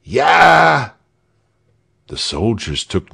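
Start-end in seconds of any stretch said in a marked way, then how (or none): 0.0-0.9 high jeering shout. A narrator's reading. Heckler in the crowd jeering the guards, a high shout. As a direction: yell it at the soldiers. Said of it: "someone shouted".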